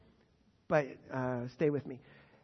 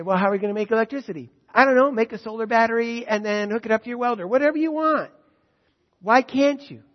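loud, high, ringing sermon voice; full-force preaching; 3 semitones higher, 10 dB louder than usual. Acted. Preacher proclaiming scripture to the congregation, full force. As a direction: proclaim it to the whole hall.

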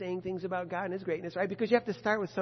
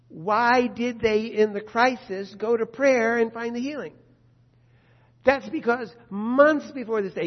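preaching at full force; 6 semitones higher, 11 dB louder than usual. Acted. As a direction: drive it home to the congregation.

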